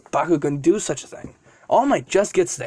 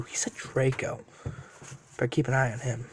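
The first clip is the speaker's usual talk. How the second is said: quiet musing to himself; 5 semitones lower, 10 dB quieter than usual. Acted. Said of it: "Then he thinks back".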